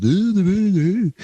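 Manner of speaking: high sung-out [name] ooh; mock [name] ooh. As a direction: coo it like a mop-top Beatle. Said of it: "like a Beatle that's like".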